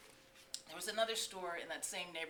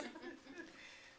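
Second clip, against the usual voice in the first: laugh; 11 semitones higher, 9 dB quieter than usual. Real, not acted.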